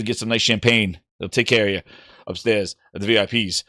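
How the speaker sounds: smooth club host. Acted. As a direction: purr it like a velvet-rope host.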